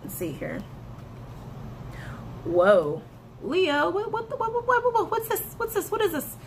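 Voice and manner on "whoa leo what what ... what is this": much higher, stammering disbelief; real astonishment at the spread; surprised. Real, not acted. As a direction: caught off guard, can't believe the cards. Shocked by the card spread.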